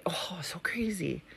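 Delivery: hushed disbelieving whisper. Real, not acted. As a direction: breathe it out as a secret. Aside about son's phobia.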